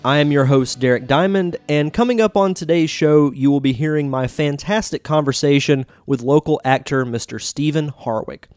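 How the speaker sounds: ordinary talk, nothing marked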